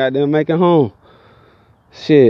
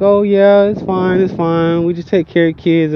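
lightly voiced woman, a little higher; imitated woman; 5 semitones higher than usual. Storyteller voicing the woman describing her hospital job, lightly put-on higher voice. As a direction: mimic her easy reassurance in a raised voice. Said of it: "She was like".

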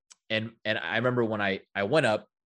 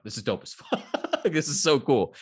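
laughing; 4 semitones higher than usual. Real, not acted. Laughing while recounting reaction.

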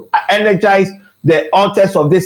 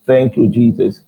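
ordinary delivery

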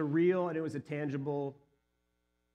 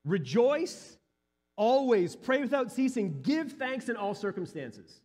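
higher, louder preaching emphasis; 6 semitones higher, 4 dB louder, slower than usual. Acted. Preacher repeating the verse to the church, emphatic. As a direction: drive the words home to the congregation.

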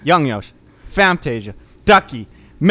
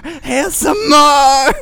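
slow, drawn-out high shout; mock ASMR shout; 11 semitones higher, 5 dB louder, much slower than usual. Host ending the ASMR bit with a slow high shout. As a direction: break the whisper with a yell. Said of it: "five seconds of A S M R voice".

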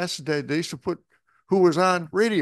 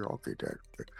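whispered, much softer and lower reply; sleepy kid reply; 15 dB quieter, faster than usual. Retold or imitated. Son answering his father at bedtime, whispered. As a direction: mumble it under the covers.

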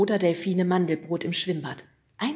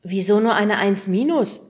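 complaining; 3 semitones higher, 10 dB louder than usual. Acted. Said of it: "beschwerte sich Fred".

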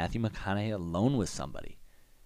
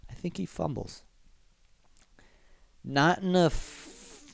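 mock voice; 6 semitones higher than usual. Mocking Shawn alone with girl.

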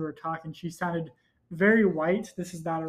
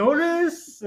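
a hint of a Spanish-accented Honduras, said high and loud; mock Spanish accent; 9 semitones higher, 5 dB louder, slower than usual. Woman switching into a Spanish accent for Honduras, hammed-up. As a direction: lightly roll Honduras the Spanish way. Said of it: "She didn't need to change her accent".

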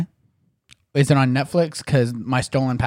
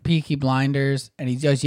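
ordinary delivery

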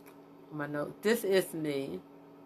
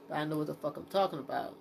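ordinary delivery

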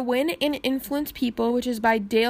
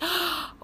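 sharp gasp of pain; 6 semitones higher, 5 dB quieter than usual. Real, not acted. Sudden rib pain.

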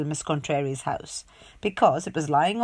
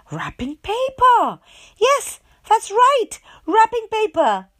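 high, loud, slow exclamation; excited discovery; 13 semitones higher, 6 dB louder, slower than usual. A narrator's reading. Mother reading the discovery to her child, excited. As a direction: gasp it out in delight. Gasp at the find.

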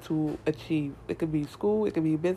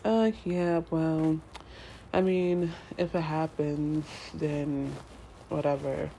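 bored shrug; 3 semitones lower, 7 dB quieter than usual. Retold or imitated. Imitating unmotivated viewers, bored and listless. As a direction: lower and softer, listless and uninterested. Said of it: "some of y'all just like".

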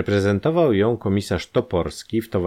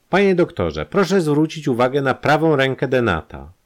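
much higher and louder call; Janusz drawing attention; 8 semitones higher, 5 dB louder than usual. A narrator's reading. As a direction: point out the clue eagerly. Janusz points out the hand.

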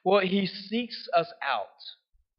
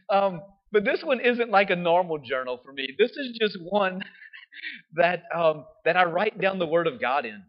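ordinary talk

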